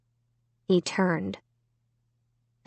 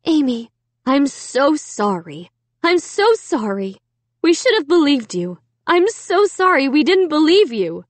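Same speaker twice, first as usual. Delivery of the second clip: higher-pitched, pleading mother's apology; emotional apology; 6 semitones higher, 3 dB louder than usual. A narrator's reading. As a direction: apologize to your daughter with rising feeling. Mother's tearful-hug apology to daughter.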